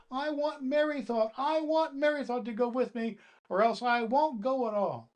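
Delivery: high, loud whining demand; tantrum demand. Acted. Doll demanding Merry Thought, high and loud tantrum. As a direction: stamp and insist.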